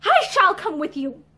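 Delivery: loud, forceful insistence; insist, voice lifted and hard; harsh voice. A narrator's reading. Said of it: "Pansy said in a harsh voice".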